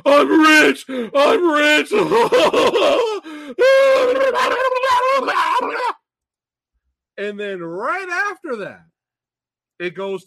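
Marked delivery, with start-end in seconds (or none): 0.1-1.9 much higher and louder triumphant cry; celebrating. Retold or imitated. The whole family jumping for joy in the movie, shouting loud. 2.0-5.9 loud, high burst of laughter; cracking up hard; big laugh. Real, not acted. Laughing hard at the film's silly scene, loud and high.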